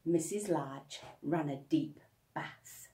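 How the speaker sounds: plain reading voice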